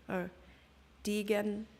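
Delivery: ordinary delivery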